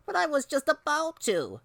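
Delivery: put-on high-pitched little-boy protest; pleading kid voice; whiny child. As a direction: whine it like a kid caught out.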